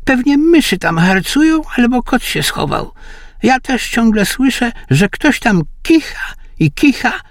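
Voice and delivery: put-on a high old man puzzling at his wife; old husband. A narrator's reading. Old husband asks his wife why the dog barks, high old voice.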